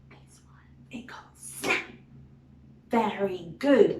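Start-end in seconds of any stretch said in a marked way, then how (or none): 0.1-1.8 voiceless whispered hint; whispering. Acted. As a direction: whisper the clue softly. Whispered hint about alligator.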